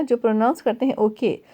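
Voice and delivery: normal talking voice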